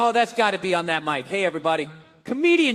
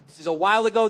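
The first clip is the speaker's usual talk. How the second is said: ordinary delivery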